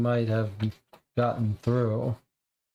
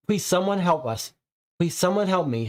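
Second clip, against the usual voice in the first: pitched-up plea for help; last frantic plea to anyone listening; desperate plea; 6 semitones higher, faster than usual. Acted. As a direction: call out for help, scared. Final plea for rescue.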